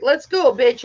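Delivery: loud, punchy fighting challenge; goading shout. Retold or imitated. Girl squaring up to her bully, loud goading. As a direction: call the bully out, loudly.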